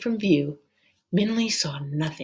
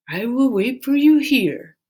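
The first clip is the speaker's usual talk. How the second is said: higher, louder dragon vow; repeated promise; 7 semitones higher, 4 dB louder than usual. A narrator's reading. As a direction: say it firmly again. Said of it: "Dragon repeated".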